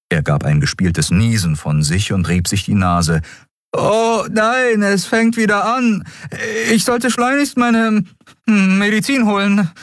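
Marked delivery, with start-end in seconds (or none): 3.8-9.7 much higher, mock alarmed boy; playing sick badly; hammed-up sniffles. Miko pretending his allergy starts, hammed up. Miko fakes sneezing fit.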